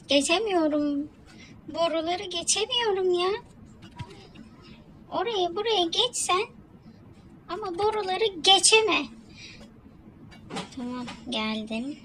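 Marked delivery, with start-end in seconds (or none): 0.1-9.1 high, loud complaining; frustrated whine. Real, not acted. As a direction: complain loudly at the game. Frustrated at failing. (sinirli, yüksek sesle sızlanma)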